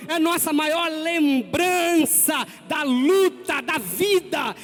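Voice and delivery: loud, high shouting sermon; full-force preaching. Acted. Pastor exhorting the congregation, shouting sermon. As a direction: preach the cross at full volume.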